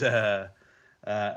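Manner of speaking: usual speaking voice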